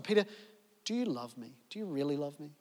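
asked softly and low, tender; gentle question. Acted. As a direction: ask him quietly, full of love. Jesus asks again, Peter answers.

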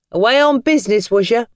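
high, loud chirpy London cabbie; a cheery cockney driver making small talk. Acted. Cabbie greeting Tom at the station, chirpy and loud. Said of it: "asked the cabbie".

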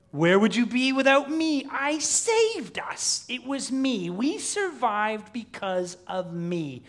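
high, loud, drawn-out tirade; raging creature. Acted. Preacher as Gollum claiming he saved Smeagol, berating. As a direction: berate your weaker self shrilly.

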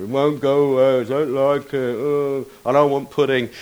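mouth-full mock whinge; hammed-up sulky child at the table; whining fussy child. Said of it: "you're sitting there going".

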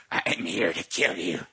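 imitated possessed man, slow and deep; voice of a different man. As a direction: drop deep and drag out the threat. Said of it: "a voice of a different man".

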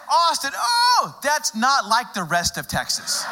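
put-on high, gushing older lady. Older lady gushing about Austin.

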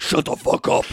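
mock-angry growl of a hand puppet; deep angry puppet voice. Puppet cuts off Jolene's question, mock deep angry voice. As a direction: imitate the puppet's gruff outburst. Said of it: "goes into a very deep, angry voice".

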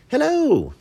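high, loud greeting; high-pitched hail; calling out hello. A narrator's reading. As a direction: sing out an eager hello at the top of the voice. Bat calls out greeting to chipmunk.

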